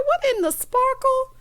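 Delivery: very high-pitched comic incredulity; appalled disbelief. Real, not acted. Vlogger reacting to Drew's sparkly bike-ride outfit, high comic disbelief. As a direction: send the voice way up, scandalized.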